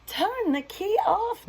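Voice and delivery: very high urgent cow voice; cow crying out. Acted. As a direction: call it out in a rush. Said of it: "Mabel cried".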